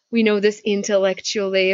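normal talking voice

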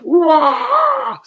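high, drawn-out "waah"; imitated wind-up cry. Wrestler winding up for a karate kick, imitated cry. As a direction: mimic a fighter's wind-up wail.

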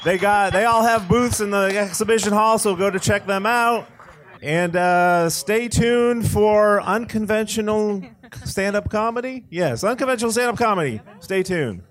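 high, projected MC voice; event announcer. Real, not acted. Host sending crowd to booths and next act, announcer voice. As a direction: announce it out to the hall.

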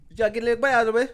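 very fast, high, loud mock Jamaican accent; incomprehensible accent imitation. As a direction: rattle it off unintelligibly in a Jamaican accent. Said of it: "They don't understand your accent".

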